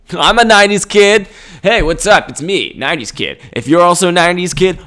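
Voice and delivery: loud, high video-intro shout; hammed-up nineties kid. Nineties kid hyping fellow nineties kids to subscribe, hammed-up shout. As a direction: pitch it big like an over-keen host.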